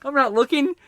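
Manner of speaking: mock-innocent protest; defensive excuse. Roommate pleading with the couple. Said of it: "He's like".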